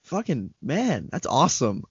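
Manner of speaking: normal talking voice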